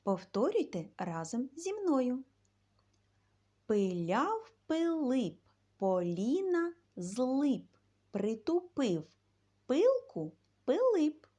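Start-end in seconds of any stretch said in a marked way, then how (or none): 3.7-11.3 louder and slow, each word of Пиляв Пилип hammered out; loud, slow chanted tongue twister; tongue twister recital. Shown as a demonstration. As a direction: recite it slowly and loudly so kids can repeat. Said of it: "Вивчимо скоромовку".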